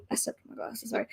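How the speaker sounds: whispered. Real, not acted. Aside while fixing glasses.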